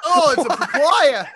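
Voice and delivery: put-on loud, high Australian-accented exclamation; big mock Aussie exclamation. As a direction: cry it out in a broad Australian accent. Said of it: "best Australian accent".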